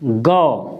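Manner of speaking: गौ said as "gau", low and drawn out; teacher sounding out "गौ"; ga with au matra. Shown as a demonstration. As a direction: model "गौ" slowly. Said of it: "ग पे लगा दी तो गौ".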